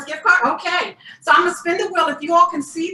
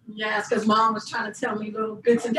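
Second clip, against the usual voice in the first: usual speaking voice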